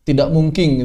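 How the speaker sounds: "mungkin" with the final n said as ng, laughing; Bugis added G. Shown as a demonstration. Teacher joking that Bugis never add G, ending "mungkin" in ng. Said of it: "suka nama-nambahin G".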